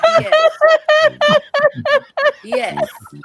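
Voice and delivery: high loud excited yes. Real, not acted. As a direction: burst out with eager recognition. Excitedly recognizing a sign.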